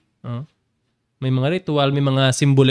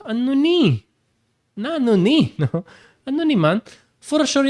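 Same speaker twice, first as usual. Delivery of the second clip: high astonished cries of the crowd; amazed crowd; 10 semitones higher than usual. Retold or imitated. Preacher voicing the amazed crowd at Capernaum, high astonished. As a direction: gasp it out like the stunned onlookers.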